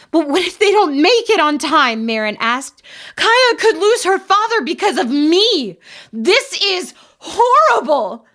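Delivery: crying. A narrator's reading. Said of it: "Marin was crying uncontrollably now".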